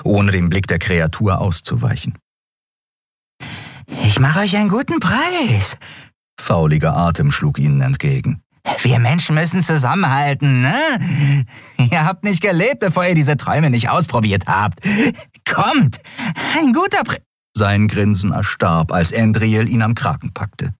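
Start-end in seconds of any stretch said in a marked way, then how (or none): 4.0-5.8 high, wheedling junkie voice; sleazy dealer. Acted. Dealer drängt weiter. 8.8-17.2 high, wheedling junkie voice; sleazy dealer. Acted. Dealer bedrängt sie.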